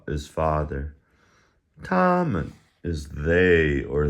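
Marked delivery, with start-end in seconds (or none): 1.8-2.5 TOMMEN high on TOM, MEN dropping away; neutral-tone ending. Shown as a demonstration. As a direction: hold TOM high and loud, let MEN fall off toneless. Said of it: "falls off at the end".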